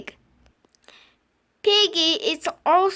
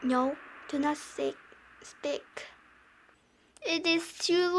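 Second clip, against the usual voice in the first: hushed plea; 4 semitones lower, 10 dB quieter than usual. Acted.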